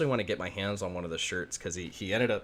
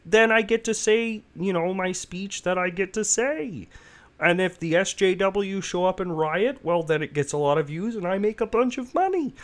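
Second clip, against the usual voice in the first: much higher, louder mocking pundit voice; pitched-up parody of a self-satisfied lecturer; Jordan Peterson impression; 12 semitones higher, 8 dB louder than usual. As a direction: lampoon a smug commentator explaining his money scheme. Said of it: "That's a good impression".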